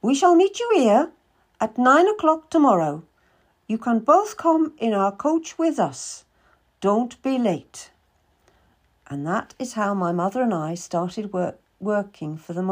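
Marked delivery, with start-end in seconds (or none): 0.0-7.9 a much higher, brisk merchant woman's voice; Madame Marchand. Acted. Madame questions the boy seeking work, higher businesslike voice. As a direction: play a shrewd, businesslike older lady.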